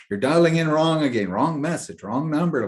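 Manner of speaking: mock fed-up plea to God; exasperated. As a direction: protest up at the sky.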